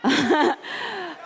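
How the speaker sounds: high bright laugh; pleased laugh after the greeting; happy laugh. Real, not acted. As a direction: laugh it off lightly.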